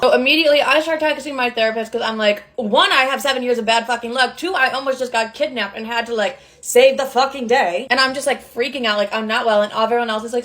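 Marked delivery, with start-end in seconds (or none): none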